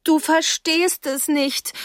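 high, drawn-out upset cry; distressed, high-pitched plaint; tearful protest. Acted. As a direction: protest slowly through tears. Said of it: "sagte ich unter Tränen".

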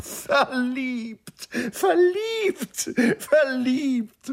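very high, drawn-out jubilant calls; gleeful cry. A narrator's reading. Nanda mocks his lovesick friend while dancing round him, gleeful high cries. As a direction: crow it out, helpless with mirth. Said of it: "rief er".

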